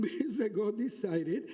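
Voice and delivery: ordinary delivery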